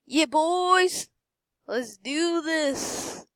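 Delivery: high rallying call; rally them high, then stretch it out; hyped-up cheer. Real, not acted. Player rallying the boys before buying, high hyped cheer. Hyping up the purchase.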